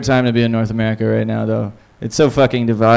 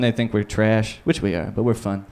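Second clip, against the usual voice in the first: normal talking voice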